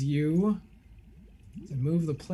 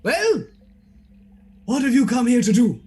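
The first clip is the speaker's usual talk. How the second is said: louder, theatrical eccentric demanding; hammed-up eccentric gentleman, loud and fast at the end; eccentric Tinby; 4 dB louder than usual. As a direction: boom it like a pompous host. Tinby demands why they came.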